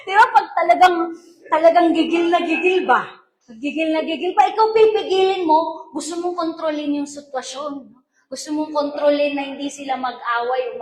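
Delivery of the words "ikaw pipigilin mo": usual speaking voice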